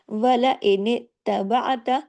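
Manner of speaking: slow, drawn-out tajweed chant; Quranic recitation. Shown as a demonstration. Teacher reciting a verse for students, slow chant. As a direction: recite it slowly with full tajweed.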